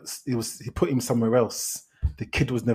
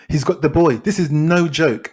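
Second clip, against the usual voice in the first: ordinary talk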